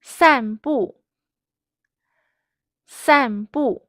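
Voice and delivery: two firm falling syllables, "San bu"; fourth tone twice. Shown as a demonstration. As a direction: drop both "San" and "bu" sharply, loud. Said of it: "Fourth tone, fourth tone".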